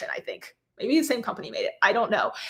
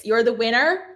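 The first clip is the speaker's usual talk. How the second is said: ordinary talk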